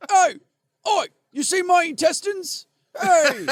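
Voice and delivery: high, loud fake Scottish holler; bad Scottish accent. Gutted Wallace hailing passers-by, squawky fake Scottish. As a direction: call out in a botched Scottish accent. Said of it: "don't have a good Scottish accent".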